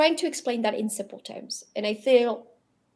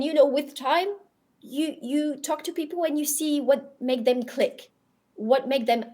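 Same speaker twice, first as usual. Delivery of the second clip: usual speaking voice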